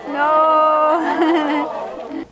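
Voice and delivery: a teasing, loud No; playful mock refusal. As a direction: tease them with a sharp fake no.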